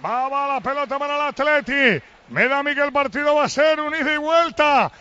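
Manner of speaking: live play-by-play. Real, not acted. Commentator calling Atleti's attack to listeners, live play-by-play. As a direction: call the match live, ringing out each phrase.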